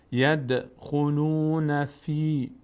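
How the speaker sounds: slow chanted recitation, echoed d in "ید"; bouncing d. Shown as a demonstration. Teacher reciting the daal rule, d released with a bounce. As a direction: bounce the d of "ید", stretch "خلون". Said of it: "بلرزه".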